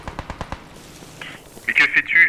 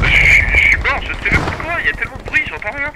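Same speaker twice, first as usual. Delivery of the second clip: high, loud frustrated cry; exasperated, high outburst; 12 semitones higher, 4 dB louder than usual. Real, not acted. Killed, deafened by noise.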